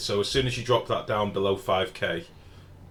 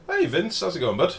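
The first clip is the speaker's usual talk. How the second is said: much higher, louder cracked-sounding greeting; greeting pitched way up by accident; voice went funny; 10 semitones higher, 7 dB louder than usual. Real, not acted. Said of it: "Wow, my voice went really funny then".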